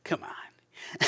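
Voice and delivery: breathy teasing whisper; mock whisper. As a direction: whisper it like a gentle nudge. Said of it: "I could hear Jesus say".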